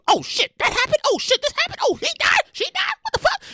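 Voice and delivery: high, rising mock surprise at the news; mock shock. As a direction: play someone scrolling in growing shock. Acting out waking to social media news.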